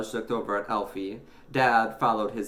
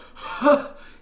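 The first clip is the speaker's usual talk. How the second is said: loud, wheezy cough; sputtering cough; 10 dB louder than usual. A narrator's reading. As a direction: cough breathlessly after calling out. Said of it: "He coughed and sputtered".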